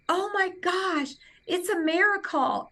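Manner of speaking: high-pitched, awestruck exclamation; amazed outcry. Retold or imitated. Patient learns the mass is gone, high amazed outcry. Reenacts her amazement at the news.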